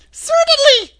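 very high, loud squeaky little voice; tiny squeaky royal mouse, quick and piercingly high; Queen of the Mice. A narrator's reading. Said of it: "in her squeaky little voice".

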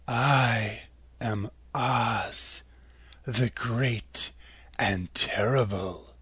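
low, soft, slow enchantress; mysterious lady. A narrator's reading. Oz as a lovely lady greeting the scarecrow, soft and low.

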